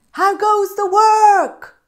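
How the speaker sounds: high, loud, slow bossy call; lazy farmer calling out to the duck, high and loud. Acted. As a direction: call down lazily from bed. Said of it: "called the farmer".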